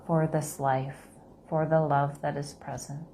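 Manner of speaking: slow, soft meditation voice; guided meditation. Acted. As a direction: gently guide them through the breath.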